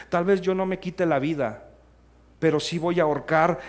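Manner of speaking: ordinary talk, nothing marked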